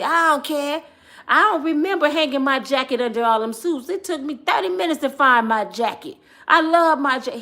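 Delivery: dad insisting on his beloved jacket, loud and stubborn; defiant dad. Retold or imitated.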